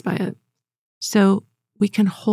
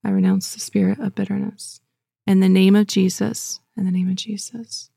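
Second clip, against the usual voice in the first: normal talking voice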